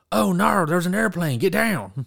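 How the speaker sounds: high, loud, badly faked Australian accent. Retelling a comedian's nine eleven joke, very poor Australian accent. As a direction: call out a warning in a terrible Australian accent. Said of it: "try to impersonate an Australian accent very poorly".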